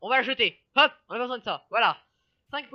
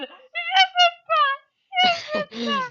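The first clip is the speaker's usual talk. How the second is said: frog voice; 8 dB louder than usual. Retold or imitated. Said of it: "il est en mode".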